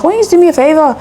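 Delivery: put-on Caribbean man's accent. Man repeats his favor request, light Caribbean accent. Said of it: "we're in Brum, so the accent".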